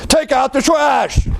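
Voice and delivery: sudden high-pitched parental yell. Acted. Parent to kids who keep ignoring them, sudden high yell. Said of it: "you might raise your voice".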